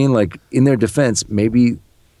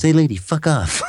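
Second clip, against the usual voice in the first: rude brush-off; 9 semitones higher than usual. Retold or imitated. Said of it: "He goes".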